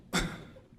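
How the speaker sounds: hard real cough; choking cough. Real, not acted. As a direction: cough to clear the throat. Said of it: "it was a macaroon".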